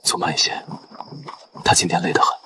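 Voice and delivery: low, soft murmur; hushed concern. Acted. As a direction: keep it down for a sleeper. Lets exhausted companion sleep.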